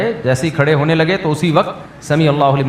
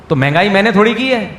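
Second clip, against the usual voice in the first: normal talking voice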